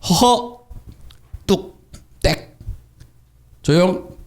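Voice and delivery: high, abrupt one-word commands with pauses; acted hushing. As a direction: cut the demon off word by word. Acting Jesus cutting off the demon.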